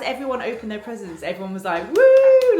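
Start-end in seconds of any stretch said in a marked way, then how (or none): 2.0-2.6 long, high, loud "woo"; cheering whoop. Retold or imitated. Everyone cheering as presents are opened, cheering whoop. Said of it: "like, cheering".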